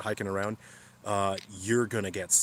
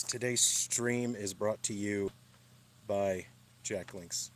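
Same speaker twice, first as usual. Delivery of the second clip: slow, drawn-out fake ad announcement; hammed-up sponsor plug, very slow; mock sponsor read; much slower than usual. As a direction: read it like a cheesy sponsor spot. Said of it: "I'm just kidding".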